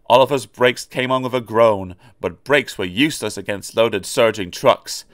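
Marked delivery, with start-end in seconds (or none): none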